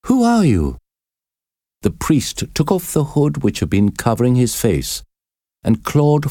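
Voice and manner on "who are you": high, sharp exclaimed question; exclaiming. A narrator's reading. Prisoner demands to know who took her hand, exclaiming. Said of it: "Esmeralda exclaimed".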